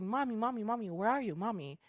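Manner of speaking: put-on high-pitched kid calling out for mom; high child's call, falling away at the end; excited child. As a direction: imitate a little boy searching eagerly for his mother. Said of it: "He was, like, super excited".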